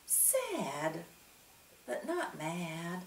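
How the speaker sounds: soft, slow, tender answer; gentle reply. A narrator's reading. Lucia telling Punchinello about Eli, soft and tender. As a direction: reassure him softly.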